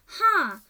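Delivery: a short loud scornful "Ha!"; scornful snort. Acted. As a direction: bark a dismissive laugh. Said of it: "snorted the cook".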